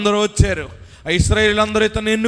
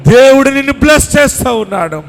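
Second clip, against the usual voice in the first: loud, high, driving sermon climax; fervent revival preaching, voice lifted high; full-force preaching; 7 semitones higher, 6 dB louder than usual. Acted. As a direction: preach it to the back of the tent. Preaching climax over music.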